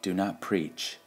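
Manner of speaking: ordinary delivery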